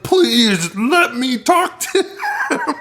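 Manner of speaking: drawn-out, high-pitched pretend wail; mock crying plea. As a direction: beg slowly in a hammed-up sob, breaking into laughter. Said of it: "[name] is crying to the operator".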